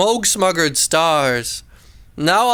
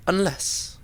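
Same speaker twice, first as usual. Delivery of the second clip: hushed reveal; 8 dB quieter than usual. A narrator's reading. The mysterious word.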